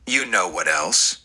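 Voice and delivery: low mock growl; a lightly voiced low growl of suspicion; jealous Simon growling at Flo. A narrator's reading. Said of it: "Simon growled".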